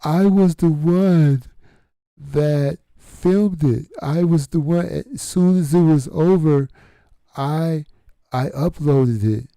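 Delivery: a mock braggart's voice, well above his own; mocking the attention-seeking lady. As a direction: ham up her boast, pitched high and loud. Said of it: "And she said".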